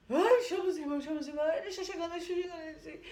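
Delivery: panicked plea. Retold or imitated. Esposa em pânico fugindo.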